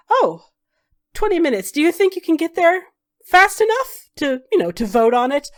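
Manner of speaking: nada tinggi dan keras, pura-pura kaget soal waktu; pura-pura panik, suara naik tinggi dan lantang; mock alarm. Magister feigns rushed surprise.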